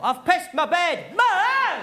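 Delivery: slow, high, loud wail rising at the end; mock-distraught kid wailing for mother; emotional child's call. Shown as a demonstration. Child calling mum after wetting the bed, emotional wail. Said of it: "there's always more emotion in that call".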